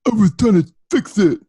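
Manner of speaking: high, halting put-on voice; hapless Rom impression. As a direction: whimper it like a dim, sorry bungler. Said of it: "why does he sound like that".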